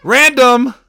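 high, loud interjection; loud, defensive exclamation; insistent shout. Acted. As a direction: shout the word to insist on innocence. Insisting on innocence to bystander.

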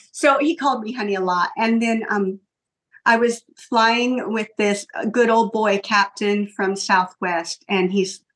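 ordinary talk

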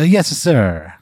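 bad Italian accent. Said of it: "a really bad Italian accent".

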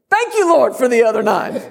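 high, loud joyful shout of thanks. Retold or imitated. Preacher repeating his shout after hitting his toe, loud and laughing. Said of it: "and I shouted".

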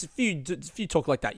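normal talking voice

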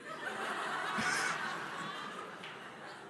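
airy high-pitched wheezing laugh; breathless high laugh. Real, not acted. The preacher cracking up remembering the prank, silent wheeze. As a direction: laugh it out without voice.

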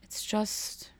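trailing into a near whisper; choked-up hush. Acted. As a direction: barely get it out, very soft. Mock choked up over the Mets.

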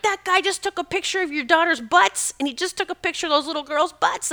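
put-on high, urgent alarm at the boyfriend; frantic outburst. Tells boyfriend and daughters about the pervert, frantic high alarm. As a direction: sound the alarm to her boyfriend. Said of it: "I went ballistic".

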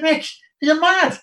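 high, loud disbelief; shocked you'd even suggest it; incredulous. Retold or imitated. Mate hearing the pub plan, incredulous.

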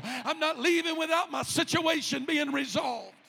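loud, driving, building sermon shout; full-force preaching. Acted. Preacher voicing those coming forward to the front, full-force preaching.

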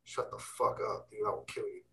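mildly menacing, under the breath; hushed threat. Acted. Speaker silencing the tempting voice in his head, hushed threat. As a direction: answer back low-volume, half voiceless. Answering back to the inner voice.

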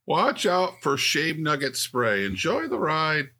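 theme park ride announcer voice; cheesy amusement park PA voice. Pretend.

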